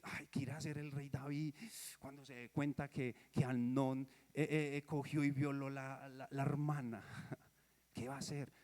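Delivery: the preacher recalling his first reading, quietly anxious; hushed wondering. Retold or imitated. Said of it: "yo dije".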